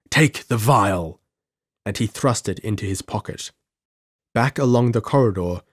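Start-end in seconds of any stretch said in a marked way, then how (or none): 0.1-1.2 slow, emphatic mild cry; understated shriek. A narrator's reading. Wounded man urging Gordon to take the vial, understated shriek. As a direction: urge it as a restrained shriek. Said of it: "I shrieked".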